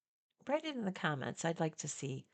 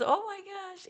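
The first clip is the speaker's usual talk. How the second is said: swooning fan. Retold or imitated. Fan gushing about Paul.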